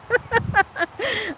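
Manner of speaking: a gentle, moved laugh. Real, not acted. Moved by fan emails.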